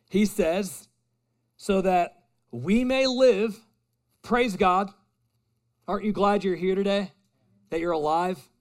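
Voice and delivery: loud, high, impassioned preaching; rising fervent pulpit cry; preaching at full force. Real, not acted. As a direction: lift the room, pour it out with fire.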